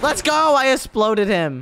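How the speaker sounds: high-pitched victory cry; triumphant cheer. Real, not acted. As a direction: crow over the kill, drawing out the end. Blows up the enemy.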